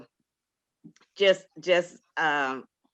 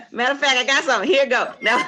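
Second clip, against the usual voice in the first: laughing; 9 semitones higher, 8 dB louder, much faster than usual. Real, not acted. Laughing flustered after poem request.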